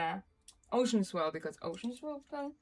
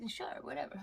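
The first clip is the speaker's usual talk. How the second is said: offhand whisper; 5 dB quieter than usual. Retold or imitated. Retold reply to classmates.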